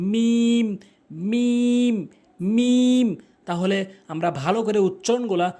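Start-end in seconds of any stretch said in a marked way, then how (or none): 0.1-3.1 "মিম" long and level, higher pitched; correct pronunciation. Shown as a demonstration. Teacher models the Arabic letter, sustained "মিম". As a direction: hold the ee of "মিম". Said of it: "মিলিত করবেন".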